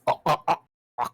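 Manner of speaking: choked, strained oh sounds; strangled voice. Shown as a demonstration. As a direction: squeeze the throat and groan it out. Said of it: "Yeah, there we go".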